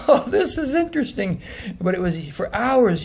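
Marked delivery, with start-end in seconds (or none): 0.0-1.4 high, laughing surprise; amused laugh. Retold or imitated. Telling viewers how the energy felt, high laughing amazement. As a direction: laugh through it, caught off guard. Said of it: "It's like".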